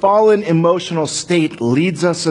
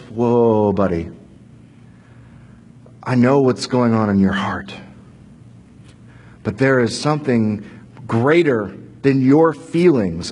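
gentle God; 6 semitones lower than usual. Acted. God gently calming angry Cain.

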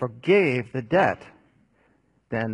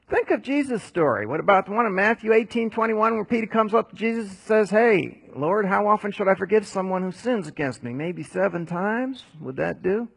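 storytelling preacher; 6 semitones higher than usual. Acted. Preacher retells Gospel with rising energy.

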